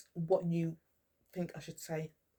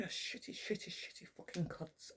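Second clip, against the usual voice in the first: hissed whisper under the breath, much softer; muttered curse; 11 dB quieter than usual. Real, not acted. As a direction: spit the insult almost voiceless. Muttered insult after angry list.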